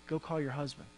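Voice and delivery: low, hushed, acted-out command; quietly imitating a firm instruction; direct, level command. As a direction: say it low and softly, eye to eye. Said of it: "looks you right in the eye".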